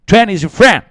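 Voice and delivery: loud, high-pitched English-accent showpiece; exaggerated English accent. Shown as a demonstration. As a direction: belt it out in a showy English accent. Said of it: "quel accent anglais".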